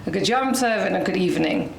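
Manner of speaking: normal talking voice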